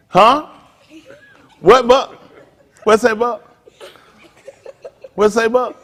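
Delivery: loud, high-pitched prodding questions; pretending not to hear. Speaker goads Buck to speak up, mock can't-hear calls. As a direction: call out as if hard of hearing. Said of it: "I can't hear you, Buck".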